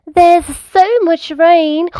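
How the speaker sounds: put-on high, loud child character voice; Peppa. A narrator's reading. Peppa at bedtime about the rain, high-pitched little pig. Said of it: "Peppa said".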